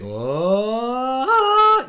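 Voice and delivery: high oh. Retold or imitated. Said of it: "people think".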